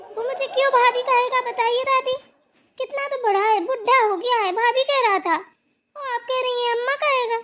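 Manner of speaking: high, loud, affronted; indignant protest. Acted. Daughter-in-law objecting to being called bhabhi, indignant.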